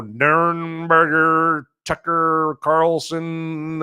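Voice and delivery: very slow, stretched syllables; drawn-out mock name. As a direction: fumble the name out at a crawl. Mocking the hard beer name.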